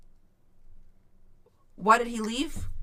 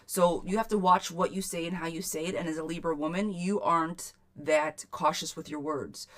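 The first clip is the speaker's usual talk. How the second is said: ordinary talk, nothing marked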